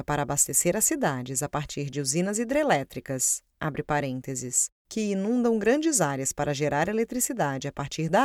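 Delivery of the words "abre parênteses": low, slow aside voice; dictating. Acted. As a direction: drop the voice to read out the brackets. Reads the brackets aloud.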